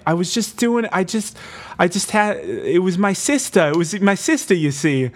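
high, flustered pleading voice; pleading excuse. Retold or imitated. Accused daughter pleading to the townsfolk, high flustered voice. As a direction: talk fast to save your skin. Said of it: "she's like".

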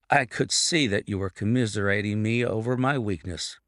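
usual speaking voice

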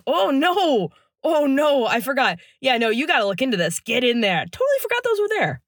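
fluster through it, faking surprise; Biden impression. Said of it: "Stellar impression as always".

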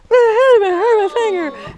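put-on high, loud pleading child voice; child showing hurt. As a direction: show off the sore finger to every stranger. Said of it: "stopped at each stranger and said".

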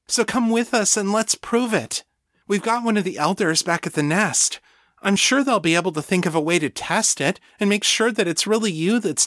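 high-pitched, eager persuasion; pleading friend Rebecca. A narrator's reading. Rebecca urging Danny to get tested, pleading.

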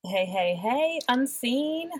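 normal talking voice

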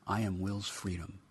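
ordinary delivery, nothing marked

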